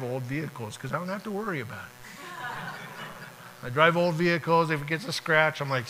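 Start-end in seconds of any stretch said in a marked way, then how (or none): 2.2-3.6 soft breathy laugh at his own joke; a quick unvoiced chuckle; airy chuckle. Real, not acted.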